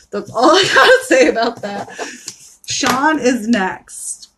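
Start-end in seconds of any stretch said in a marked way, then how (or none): 0.1-1.9 laughing through the words. Real, not acted. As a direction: giggle it out. Laughs through closing line.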